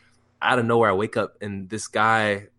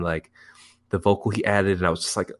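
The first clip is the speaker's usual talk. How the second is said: ordinary talk, nothing marked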